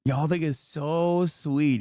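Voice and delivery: slow, drawn-out taunt; slow mock-sweet needling. Real, not acted. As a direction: stretch it out scornfully at them. Taunting the chat with slow repeats.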